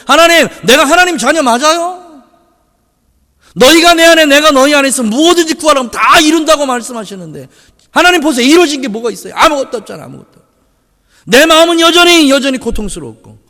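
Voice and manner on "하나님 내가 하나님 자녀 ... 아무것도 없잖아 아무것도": retold desperate prayer. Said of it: "기도하는데 그 기도가 응답이 안 되는 것 같아".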